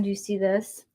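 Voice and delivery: ordinary talk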